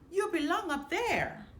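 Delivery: usual speaking voice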